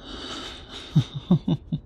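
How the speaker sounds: airy breathy chuckle; a hushed pleased little laugh. Acted. Pleased by an answer.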